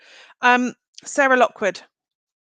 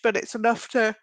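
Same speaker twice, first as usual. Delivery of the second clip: yawning; 2 semitones higher than usual. Real, not acted. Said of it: "Sorry, I only yawn when I transmute".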